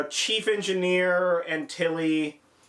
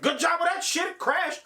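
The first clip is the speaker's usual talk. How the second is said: sarcastic jab, pitched way up; mocking Stamets; 11 semitones higher than usual. Stamets mocking her for crashing the ship, high sarcastic sneer. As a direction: sneer it up high like a snide put-down. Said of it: "And he's like".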